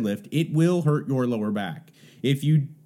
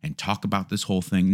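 ordinary delivery

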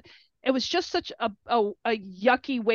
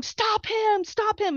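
imitating own panicked screech; retold witchy shriek; 10 semitones higher, slower than usual. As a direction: cry it out high like the Wicked Witch. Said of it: "I sound like the Witched Witch of the West".

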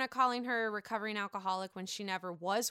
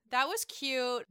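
ordinary delivery

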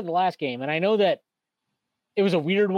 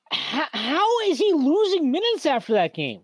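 exasperated disbelief; 7 semitones higher than usual. Real, not acted. Exasperated rhetorical question.

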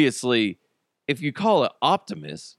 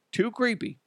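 ordinary talk

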